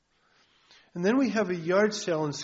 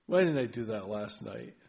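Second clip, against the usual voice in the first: morning groan; 6 semitones lower than usual. Retold or imitated. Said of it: "look at the sink and go".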